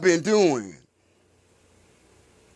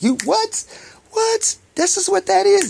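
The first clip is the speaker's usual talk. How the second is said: high, disbelieving double take; incredulous what. Retold or imitated. To himself, mocking the reaction with a high incredulous what. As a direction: jump up high in mock shock.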